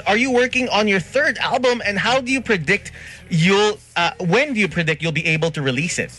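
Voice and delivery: ordinary talk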